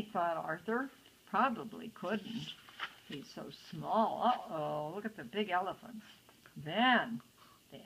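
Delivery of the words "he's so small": ordinary delivery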